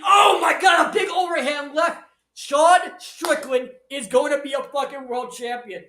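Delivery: loud, high outburst, easing slightly; excited shout. Real, not acted. Commentator calls a big overhand left, shouting in real excitement. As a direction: erupt at the big punch. Big punch seals the upset.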